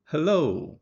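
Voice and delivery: hello with a lifted, loud melody, HEL-lo sung upward; a loud, high, clearly shaped hello; marked intonation. Shown as a demonstration. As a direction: greet with a bright rising tune on hello. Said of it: "Olha a entonação marcada aqui".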